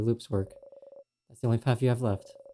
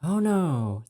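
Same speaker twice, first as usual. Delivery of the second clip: higher, louder alarmed gasp of words; startled oh no; 6 semitones higher, 4 dB louder than usual. Acted. Hiding in a bathroom stall, alarmed by her own noise. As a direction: caught off guard, a sharp worried oh no.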